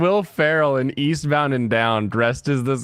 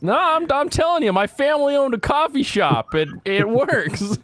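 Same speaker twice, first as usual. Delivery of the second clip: mock Tim; 12 semitones higher than usual. Mimicking Tim defending.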